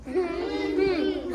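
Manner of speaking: closed-mouth teaching hum; even, wordless hum; hummed syllables. Shown as a demonstration. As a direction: hum the word in beats. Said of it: "Hum it".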